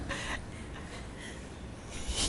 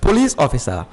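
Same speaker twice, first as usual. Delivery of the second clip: emphatic; 9 semitones higher, 6 dB louder than usual. Real, not acted. Explaining example emphatically.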